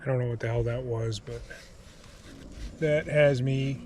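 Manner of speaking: subdued, halting, truly unnerved; spooked and trying to hold it together; real fear. Real, not acted. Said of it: "I'm freaking out right now".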